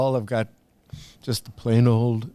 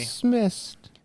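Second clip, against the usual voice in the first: put-on baby voice; 11 semitones higher than usual. Baby gives an order.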